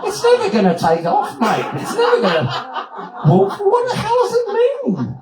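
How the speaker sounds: loud, high-pitched mock outrage; scoffing disbelief. Teller dismissing the movie's title to Doug, scornful.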